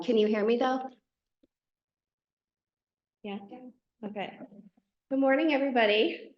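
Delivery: ordinary talk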